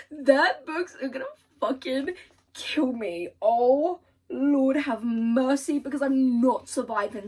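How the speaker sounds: loud mock-dramatic anguish; dramatic despair. Real, not acted. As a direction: wail that the books will kill her. Overwhelmed reader reacting.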